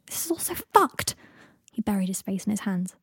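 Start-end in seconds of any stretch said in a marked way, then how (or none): 0.1-1.1 high, slow, drawn-out outburst; frustrated shout. A narrator's reading. Harry despairing in the kitchen, shouting in frustration. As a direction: let the pitch leap in exasperation. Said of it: "he shouted".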